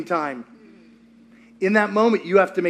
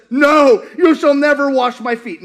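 Peter refusing; 4 semitones higher, 6 dB louder than usual. Retold or imitated. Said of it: "And Peter says".

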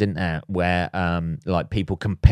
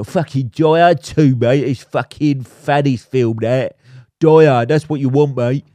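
louder, higher ranting, carried away; worked-up rant; 3 semitones higher, 6 dB louder than usual. Real, not acted. Podcaster ranting about Die Hard two until he must calm down, loud. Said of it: "let's calm down".

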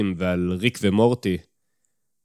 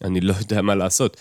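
plain reading voice

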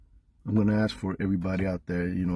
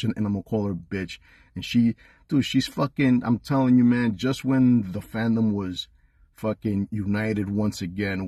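passionate rant; 2 semitones higher, 4 dB louder than usual. Real, not acted. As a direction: argue it with real heat, voice pushed up. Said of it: "You can hear the passion in his voice".

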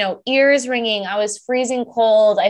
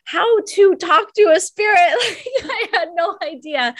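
higher, laughing it out; amused at herself; 7 semitones higher than usual. Real, not acted. Laughing at own naivety.

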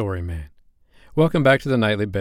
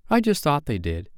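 higher-pitched, eager interruption; excited; 6 semitones higher than usual. A narrator's reading. Curly breaking into Wendy's story, excited. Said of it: "Curly broke in excitedly".

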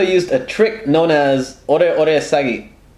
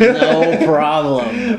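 put-on playful baby voice; 7 semitones higher, much slower than usual. Baby voice in crow bit.

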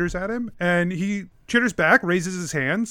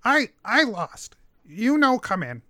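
halting little creature, pitched up then dropping; kobold; 4 semitones higher than usual. Acted. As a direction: refuse entry as a small kobold. GM voices the kobold.

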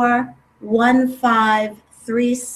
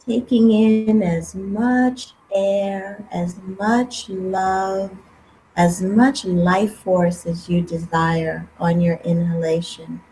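guided meditation; 3 semitones lower than usual. Acted. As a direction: calmly guide them, unhurried. Leads a guided breathing meditation.

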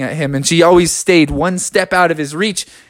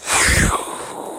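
voiceless rush of air; take-off whoosh; 5 dB quieter than usual. A narrator's reading. Narrator sounding the friends taking off, airy whoosh. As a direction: blow out a whoosh of flight.

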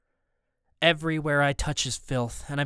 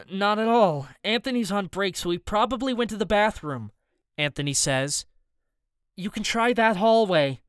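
higher nervous cook voice; Anthony's voice, higher; 6 semitones higher than usual. Acted. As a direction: lie smoothly but a bit high.

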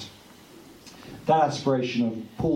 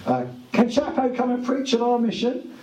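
high-pitched, hammed-up caller's voice; hopeful phone caller; 12 semitones higher than usual. Organiser phoning to book Chapo for a mission, high hopeful put-on voice. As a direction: put on a high, quick, asking voice.

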